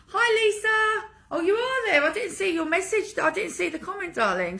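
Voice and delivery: bright, high live-chat hello; suddenly spotting a viewer; surprised greeting. Real, not acted.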